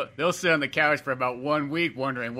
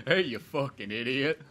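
slow, drawled Southern taunt; slow Gump drawl, insulting with swagger; mean Forrest Gump; slower than usual. Retold or imitated. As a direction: lean on Forrest like a bully. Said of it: "talking in that accent".

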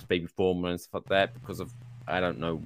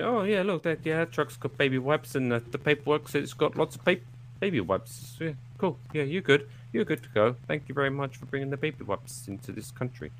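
higher, softer mock-official chatter; easygoing border officer; 6 semitones higher, 5 dB quieter than usual. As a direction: casually wave the driver through. Acts border officers waving truck through.

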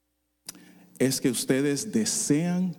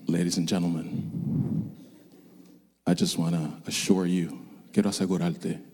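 calm pilot announcement; 9 semitones lower than usual. Retold or imitated. Pilot reassuring passengers during turbulence, low and calm. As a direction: play the unflappable pilot soothing passengers. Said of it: "El piloto sale bien calmado".

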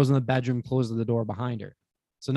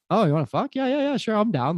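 higher-pitched, hammed-up eagerness; eager agreement; 9 semitones higher than usual. Storyteller replaying his yes to the tour-group girl, playfully over-eager. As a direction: jump at the offer, piling up the yeses.

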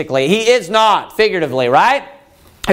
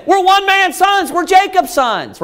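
high, emphatic pleading; insistent appeal; 9 semitones higher than usual. Acted. Israelites appealing to their father Jacob, insistent. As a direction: protest your lineage, voice raised high. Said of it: "appeal to the fact that".

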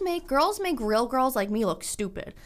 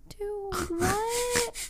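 high, soft incredulous squeak; mock-stunned, pitch shooting up; retold disbelief; 11 semitones higher, 6 dB quieter than usual. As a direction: play up being quietly appalled. Replaying her stunned reaction.

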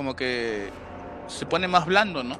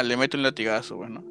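usual speaking voice

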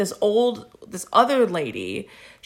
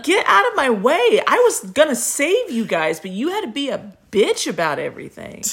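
high, hammed-up scolding that comes down; exaggerated indignant outburst; mock angry rant; 6 semitones higher than usual. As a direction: tell them off as a put-upon robber. Playing robber telling lady off.

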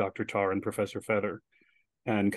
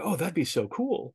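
higher, drawn-out delight; excited agreement; 6 semitones higher than usual. Retold or imitated. Filipino students welcoming a scene in Tagalog, excited. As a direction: voice eager students jumping at the idea.

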